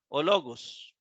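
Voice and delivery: "o logos" joined as a single word; "o logos" with no break after the "o"; run together as one word. Shown as a demonstration. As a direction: lean unaccented "o" straight onto "logos". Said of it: "como si fuera una sola palabra".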